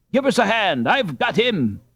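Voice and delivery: high urgent call for help. A narrator's reading. Boy holding a robber calls friends for help. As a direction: shout for a hand.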